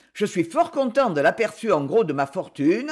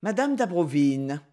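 usual speaking voice

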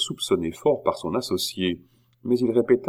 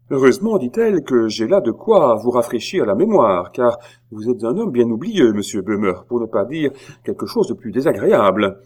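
haughty scolding; 4 semitones higher, 7 dB louder than usual. Acted.

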